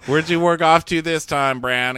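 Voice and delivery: hint of an exasperated character; lightly voiced Sansa. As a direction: call Bran out, voice lifted. Acted Sansa questioning Bran.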